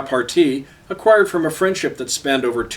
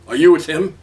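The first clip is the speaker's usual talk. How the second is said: demanding; 3 semitones higher, 5 dB louder than usual. A narrator's reading. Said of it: "he demanded".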